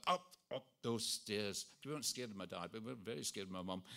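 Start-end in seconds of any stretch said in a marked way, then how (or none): 0.1-1.7 drawn-out, slow maternal command; stern mother. Retold or imitated. Mother catches sneaking children on the stairs, mock-stern and slow.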